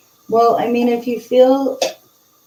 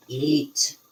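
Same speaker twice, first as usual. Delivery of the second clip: lower, slightly eerie imitation; scary spirit voice; 6 semitones lower, 4 dB quieter than usual. Telling what the recording said, in a scary low voice. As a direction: drop your pitch to mimic the recorded voice. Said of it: "did it sound scary like you said".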